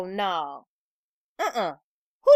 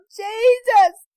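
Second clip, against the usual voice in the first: high, wailing panic breaking into shouts; hysterical pleading; 8 semitones higher, 5 dB louder than usual. A narrator's reading. Crying wife begging Red to get dog off, hysterical. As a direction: plead frantically, ending in a loud cry.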